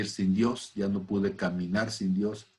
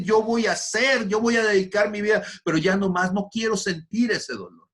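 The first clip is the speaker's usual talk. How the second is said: loud, much higher anguished vow; desperate shout; 10 semitones higher, 4 dB louder than usual. Retold or imitated. Man screaming in anguish to God, loud desperate cry. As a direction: cry out that you can't bear the pain. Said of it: "yo estaba gritando desesperado".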